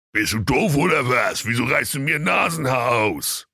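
a hint of a yelping devil; whining devil. A narrator's reading. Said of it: "rief der Teufel".

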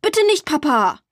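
hoch und flehend protestiert; meuternd flehend. A narrator's reading. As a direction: bettel Papa an, Borg nicht wegzugeben. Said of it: "und auch Matti meuterte". (Matti meutert, hoch flehend, gegen Papa)

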